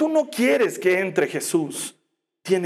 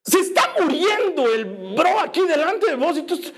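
high, shouted plea to Jesus; father yelling that his son is dying; desperate father; 9 semitones higher than usual. Acted. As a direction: cry out like a frantic father. Father pleads desperately in retelling.

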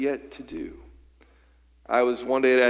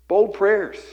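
ringing declaration; 7 semitones higher, 6 dB louder than usual. Acted. Sermon climax exclamation.

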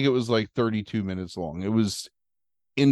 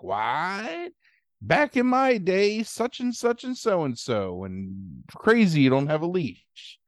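high, slow mock old lady outrage; indignant old lady; 5 semitones higher, slower than usual. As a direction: lightly play a huffy old woman. Old woman indignant, voiced.

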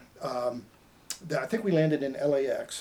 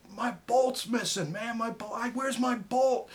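high, slow, distressed asking; pleading, alarmed, dragging each word out; retold panic; 9 semitones higher, slower than usual. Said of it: "I could tell he was upset".

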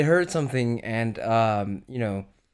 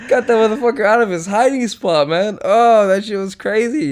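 excited; 9 semitones higher, 6 dB louder than usual. Real, not acted. Still hyped after rat chase.

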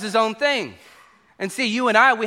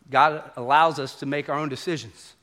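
usual speaking voice

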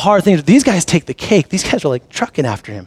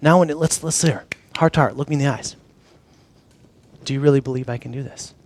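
soft, low and intimate; hushed, sincere one-on-one appeal; heart-to-heart question; 4 semitones lower, 6 dB quieter than usual. Acted. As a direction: lower the voice and ask it earnestly, with pauses. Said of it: "he wanted them one last time saying".

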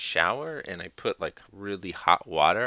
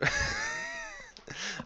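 high squealing laugh; 4 dB quieter than usual. Real, not acted.

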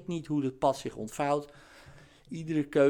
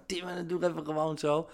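higher, hurried jeer after a put-on laugh; mocking laughter; 4 semitones higher, faster than usual. Scoffers ridicule the son's carpentry plan, mock laughing. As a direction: laugh at the idea in imitation of scoffers. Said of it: "moeten ze erom lachen".

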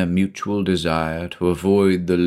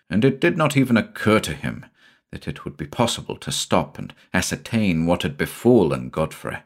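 ordinary delivery

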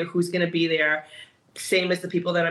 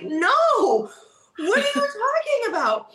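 much higher, laughing protest; excited disbelief; 3 dB louder, slower than usual. Retold or imitated. To a fellow contestant who wants to leave, much higher excited protest. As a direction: squeal it out in happy disbelief.

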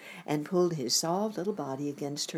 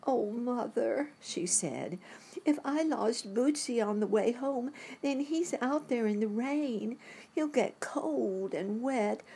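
put-on tearful little girl; 9 semitones higher than usual. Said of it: "tears came to her eyes".

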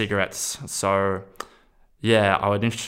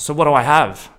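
higher and louder pointed challenge; demanding question; 6 semitones higher, 9 dB louder than usual. Retold or imitated. Asking the psychiatrist, a loud pointed challenge. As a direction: push him for an answer. Asks psychiatrist his diagnosis.

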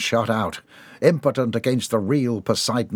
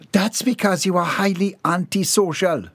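high, lightly voiced chiding; 8 semitones higher than usual. A narrator's reading. Missus Dixon chiding the butler, mild high scold. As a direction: scold him lightly, higher. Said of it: "Missus Dixon chided".

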